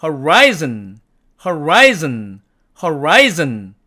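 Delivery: horizon with a curled American r; horizon, American r, mouth not rounded. Shown as a demonstration. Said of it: "어떻게 소리를 내는지 이해가 되시나요".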